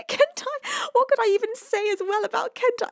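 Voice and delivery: high, giggly voice; laughing fondly. Real, not acted. Streamer laughs fondly while thanking her friend. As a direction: laugh through the words.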